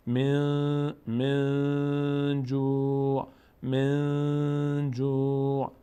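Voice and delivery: slow level chant, من جوع with the n hummed through the nose; nasalised hidden n. Shown as a demonstration. Teacher demonstrates ikhfa, nasalised hidden n. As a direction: hold the n of من in the nose, then draw out جوع.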